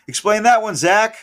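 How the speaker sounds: high, loud challenge to Zach; calling out. Real, not acted. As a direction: demand an answer, mock-indignant. Calling out Zach over setlist.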